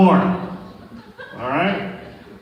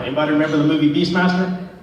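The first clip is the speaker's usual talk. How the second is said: usual speaking voice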